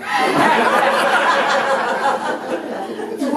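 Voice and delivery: cracking up at his own story; big laugh. Real, not acted. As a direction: burst out laughing at himself.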